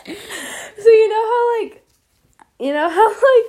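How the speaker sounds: laughing. Real, not acted. Explaining while laughing hard.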